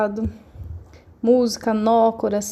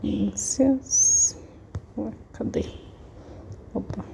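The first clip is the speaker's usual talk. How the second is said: muttering to herself; 6 dB quieter, slower than usual. Real, not acted. Teacher searching for the book.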